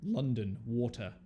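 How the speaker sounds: slow, measured London accent; London English accent. Shown as a demonstration. English friend teaching a classic phrase, London accent. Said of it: "That's the classic".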